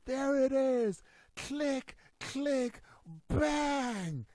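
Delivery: imitating odd commentary. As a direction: parrot the strange broadcast sounds. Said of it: "All I hear is".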